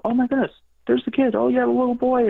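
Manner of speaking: very high, loud mock amazement; imitating excited intuitives. Intuitives walking up and spotting the little boy, imitated excitement. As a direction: gush like someone thrilled by a sighting.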